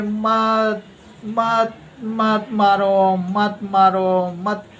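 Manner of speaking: high, long drawn-out wailing plea; mock begging. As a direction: imitate grovelling for mercy, stretching every word. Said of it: "यही भीख मांगते हैं ये लोग". (गिड़गिड़ाने की नकल, ऊँचे सुर में खींचकर)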